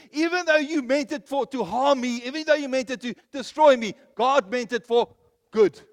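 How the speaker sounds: loud, high scripture declamation; preaching at full force. Acted. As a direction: proclaim the verse loudly to the congregation. Preaches a Bible verse.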